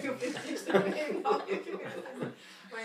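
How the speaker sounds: loud, high laughing talk. Real, not acted. Next participant amused as she begins introducing herself, laughing. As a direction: start your introduction through laughter.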